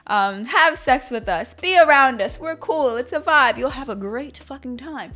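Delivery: enthusiastic invitation. Acted. Couple inviting someone into their bedroom, enthusiastic pitch. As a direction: hype up the invite, playfully.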